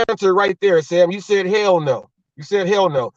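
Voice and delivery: ordinary talk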